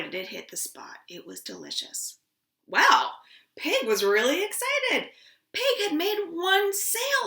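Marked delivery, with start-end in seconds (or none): 0.0-2.2 soft delighted whisper; savoring whisper. A narrator's reading. Storyteller whispers how tasty the potato was. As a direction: hush it, as if it tastes wonderful.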